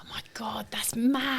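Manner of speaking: amazed whisper. Real, not acted. Amazed at being recognised.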